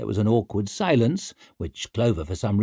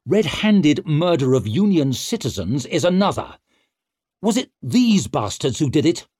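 snarling; 5 semitones higher, 4 dB louder than usual. A narrator's reading. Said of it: "He snarled at the trussed-up men".

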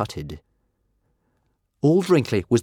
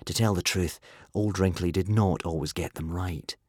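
plain reading voice

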